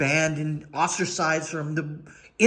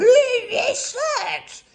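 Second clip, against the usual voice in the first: high laughing mock scream; hammed-up shriek. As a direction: yell it like a meltdown on camera, half laughing. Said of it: "They're screaming into a camera".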